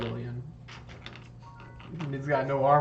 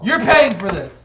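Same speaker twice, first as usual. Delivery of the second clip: loud, raised angry shout; angry demand; 5 semitones higher, 11 dB louder than usual. Acted. Zebediah blames them for the doll, shouting angrily. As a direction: yell the demand, outraged. Crying character demands payment.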